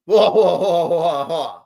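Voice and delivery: laughing, fading out by the end; laughing whoa. Real, not acted. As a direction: laugh it off, then trail away.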